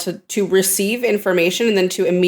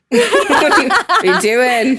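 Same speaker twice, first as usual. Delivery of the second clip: laughing imitation of Jamie's British accent; 4 semitones higher, 3 dB louder, much slower than usual. Fan quoting Jamie after he fakes them out, mimicked British accent. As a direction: mimic Jamie's accent, drawing out the last question. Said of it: "I love his accent".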